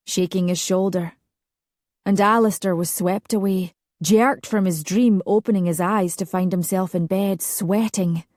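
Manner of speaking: ordinary delivery, nothing marked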